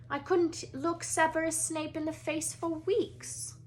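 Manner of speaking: clipped headmistress asking politely; a stern older Scottish witch at a classroom door; McGonagall voice. Acted.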